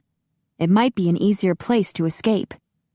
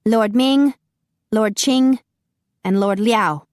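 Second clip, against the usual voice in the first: raised, ringing herald's call; formal announcement; 3 semitones higher, 4 dB louder, slower than usual. A narrator's reading. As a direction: announce the names to the hall. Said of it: "Wu Shen announced".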